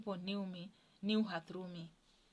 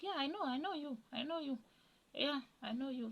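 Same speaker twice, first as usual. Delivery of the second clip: voicing George; 6 semitones higher than usual. George reassuring her, voiced high.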